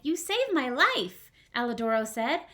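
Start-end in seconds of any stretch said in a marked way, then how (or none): 0.0-1.3 puppet voice. Acted. Pinocchio thanking.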